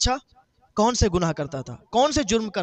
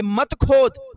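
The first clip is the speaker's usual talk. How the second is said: loud, slow, higher forbidding; slow stern command; 5 semitones higher, 5 dB louder, much slower than usual. Retold or imitated. Grave voice forbids the brother, loud and slow. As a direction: drag out the command to stop digging.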